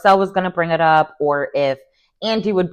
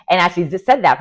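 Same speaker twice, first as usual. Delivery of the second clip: ordinary delivery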